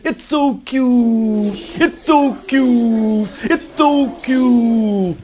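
high, slow cooing over the bird; cooing kids. Acted. Whole class cooing at a little bird, high and slow. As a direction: melt over something adorable. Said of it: "everybody said".

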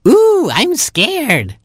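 very high, drawn-out scared chick; tiny frightened chick voice; Baby Chick. A narrator's reading. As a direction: squeak it slowly with mock fright. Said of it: "said Baby Chick".

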